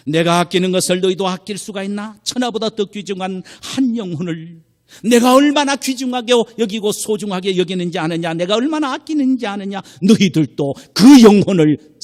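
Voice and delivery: loud, high, impassioned preaching; God questioning. Retold or imitated. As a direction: build to a forceful plea as God. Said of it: "하나님은 우리에게 묻고 계십니다". (하나님의 음성으로 외치는 격정적인 설교)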